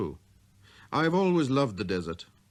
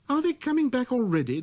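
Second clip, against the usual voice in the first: put-on high, light boyish voice; a small boy's clear greeting; little prince voice; 4 dB louder than usual. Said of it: "demanded the little prince".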